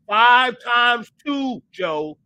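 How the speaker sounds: shouted slow quiz. Retold or imitated. Parent yelling a times-table question at a stalling kid. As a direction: bellow it slowly, one word at a time. Parent drilling a child on math.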